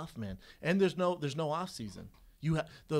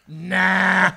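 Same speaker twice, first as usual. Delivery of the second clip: much louder, flat-out nah; dismissive refusal; 6 semitones higher, 17 dB louder than usual. Retold or imitated. Turning down the bet for the old truck, loud scoffing nah. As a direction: brush him off with a loud scoff.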